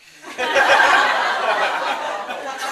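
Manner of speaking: loud breathy laugh; burst of laughter. Real, not acted. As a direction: crack up laughing. Narrator cracks up mid-line.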